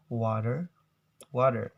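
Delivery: "water" with the t as a quick d, "wader"; "water" the American way, like "wadder". Shown as a demonstration. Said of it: "the T sounds become D".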